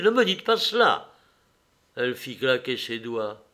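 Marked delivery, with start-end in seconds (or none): 0.0-1.1 high, louder indignant protest; angry cry. A narrator's reading. Man to the jeering woman, crying out angrily. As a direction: cry out to forbid it. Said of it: "cria-t-il".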